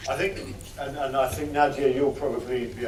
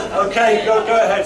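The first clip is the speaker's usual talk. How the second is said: laughing; 7 semitones higher, 12 dB louder than usual. Real, not acted. Host still laughing, inviting question.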